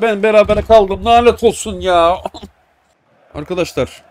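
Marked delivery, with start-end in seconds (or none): 0.0-2.2 frustrated groan. Real, not acted. Gamer frustrated at draw.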